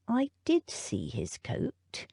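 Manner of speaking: slow, soft, measured butler; a hint of a thoughtful old butler; musing butler. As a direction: weigh each word quietly. Butler Featherby muses.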